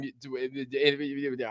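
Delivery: soft, garbled stammering; mock seizure stutter. Host tangled up in his welcome to a newcomer, mock stutter. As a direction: stumble and stutter as if glitching. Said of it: "I'm having a seizure right now".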